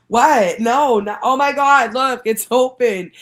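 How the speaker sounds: high, loud, thrilled disbelief; excited mock protest. Showing friends the open phone, loud excited. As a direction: act gleefully shocked. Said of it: "And I was like".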